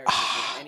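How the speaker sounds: soft breathy "ah" after a sip; just a quiet exhale of relief; refreshed sigh. Shown as a demonstration. Soda drinker after a sip, soft breathy sigh. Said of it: "we just go".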